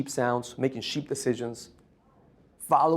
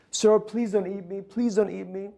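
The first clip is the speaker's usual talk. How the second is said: higher, pleading little-lion voice; frightened little lion; 6 semitones higher than usual. Acted. Little lion pleading with the big lion, higher and begging. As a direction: beg not to be eaten, voice pitched up. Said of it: "He goes".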